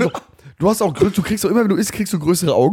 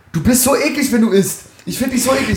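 higher, disgusted teasing; disgusted accusation; 6 semitones higher than usual. Real, not acted. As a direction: tell him he's gross with mock disgust.

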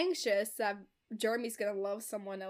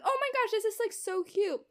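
high, bright mock gush, imitating Jeremy; hammed-up excited father; gushing excitement; 9 semitones higher, 4 dB louder than usual. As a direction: squeal it like a giddy dad-to-be. Jeremy gushing over baby names.

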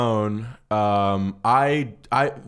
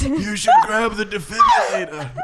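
put-on high, slow old-man shout; hammed-up old-timer in a panic; 13 semitones higher than usual. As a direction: holler it slowly, pitched way up. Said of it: "Like an old man".

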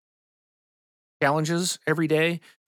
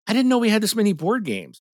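surprised; 9 semitones higher than usual. Retold or imitated. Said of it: "I was like".

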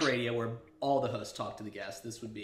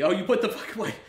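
mock viewer voice; 7 semitones higher, 8 dB louder than usual. Acts out a viewer watching on Twitch.

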